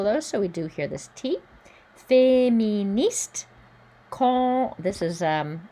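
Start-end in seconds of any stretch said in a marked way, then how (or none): 2.1-3.5 Féministe slow and higher, final T heard; careful French Féministe; French pronunciation. Shown as a demonstration. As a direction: sound out Féministe with the end T. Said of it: "don't pronounce the E".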